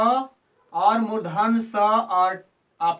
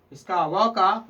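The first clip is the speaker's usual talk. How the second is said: ordinary delivery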